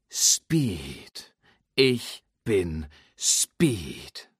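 very slow, drawn-out self-chant; slow mantra. Acted. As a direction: stretch each word like a focusing mantra. Lightning psyching himself up.

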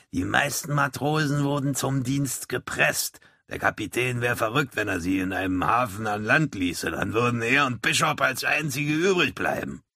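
lightly voiced old toothless seaman; an old salt explaining how things go; old sailor. A narrator's reading. The oldest sailor explains the press gang, lightly voiced old man.

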